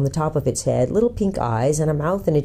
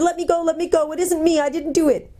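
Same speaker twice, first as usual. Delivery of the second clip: high-pitched panicked gnome voice; squealing; 13 semitones higher than usual. A narrator's reading. Captured gnome begs the prince to let him go, squealing. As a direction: squeal and plead in a high creature voice. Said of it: "squealed the Earthman".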